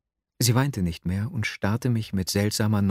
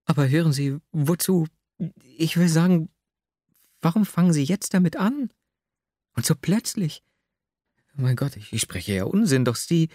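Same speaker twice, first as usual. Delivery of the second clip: put-on higher, aggrieved young woman's lament; Nastjenka's voice; 7 semitones higher than usual. A narrator's reading. Young woman complains of her cruel lover, higher and hurt. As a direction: plead bitterly in a higher voice.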